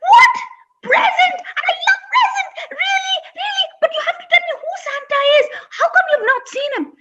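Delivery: high, loud and fast; duckling thrilled about presents, high and loud. Acted. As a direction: squeal with delight.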